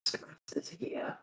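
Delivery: whispered warning in character; urgent whisper. Acted. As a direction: whisper it to warn a friend. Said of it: "Dewey is like this".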